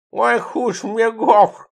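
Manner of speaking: high, slow lisped "хуш мне гоф"; lisping drunk friend, high and drawn out. Acted. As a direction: lisp through missing teeth, high and slow. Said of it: "прошепелявил он".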